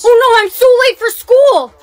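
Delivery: high, loud alarmed cry; panicked. Acted. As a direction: jolt up in panic. Panicked wake up.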